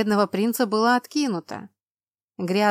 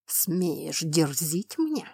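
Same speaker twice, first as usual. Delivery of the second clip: restrained fury; 3 semitones lower, 4 dB quieter, much slower than usual. Acted. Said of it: "Сдерживая ярость".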